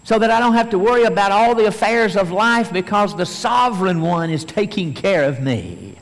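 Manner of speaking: loud, high rising assurance; fervent preaching. Acted. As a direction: press the point home. Fervent sermon climax.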